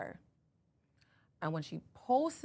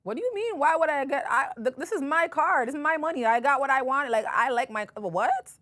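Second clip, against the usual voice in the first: higher, louder, exasperated retort; indignant disbelief; 7 semitones higher, 7 dB louder than usual. Retold or imitated. To her friend on the phone, indignant and louder. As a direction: push back, baffled and offended.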